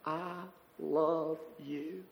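embrace him with every word; loving welcome. Retold or imitated.

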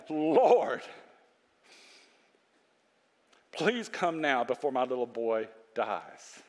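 low, slow, softened pleading; subdued plea. Acted. Preacher as the official begging Jesus for his son, subdued plea. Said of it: "The official pleaded".

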